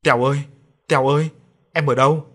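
loud searching call. A narrator's reading. Sisters searching for their little brother, calling loudly. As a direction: call his name across the fields. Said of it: "vừa đi vừa gọi".

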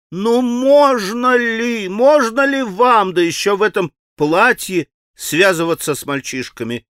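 high, slow, scolding; angry outcry. Acted. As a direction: scold him as if she had the right. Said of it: "гневно вскричала она".

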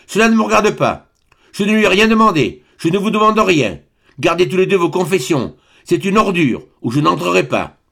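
put-on roaring baron silencing the young man; thundering shout. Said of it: "cria d'une voix de tonnerre".

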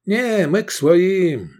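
put-on high, slow boyish reply; young highlander. A narrator's reading. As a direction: play a wiry young mountain lad.

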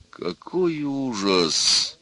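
slow, high, drawn-out moan. A narrator's reading. Groom's father lamenting, drawn-out moan. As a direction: moan it out in horror. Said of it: "простонал отец жениха".

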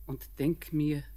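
ordinary talk

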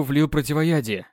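usual speaking voice

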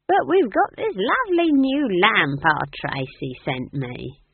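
slow, high, loud show of innocence; innocently offered, in a high slow voice. A narrator's reading. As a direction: play innocent, drawing the words out. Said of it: "said Nanny Ogg innocently".

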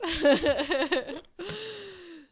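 laughing out loud; hearty laugh. Real, not acted. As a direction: burst out laughing. Laughing at own joke.

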